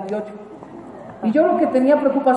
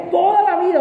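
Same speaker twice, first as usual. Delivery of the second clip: high, emphatic disbelief; stunned realization; 6 semitones higher than usual. Acted. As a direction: exclaim it as it sinks in. Shocked thought repeated.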